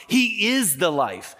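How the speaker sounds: slow, every word weighted, "is" hit hardest; slow stress on is. Acted. Preacher to congregation, slow heavy stress on "is". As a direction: drive "is" home slowly. Contrast: not telling, is the life.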